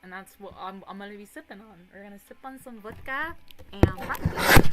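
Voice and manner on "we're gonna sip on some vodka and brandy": much louder and higher, hyped up; loud excited announcement. Real, not acted. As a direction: shout it out to the chat like a party toast. Announcing her drinks to chat.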